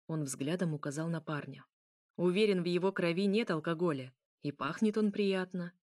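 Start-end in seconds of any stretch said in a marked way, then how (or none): none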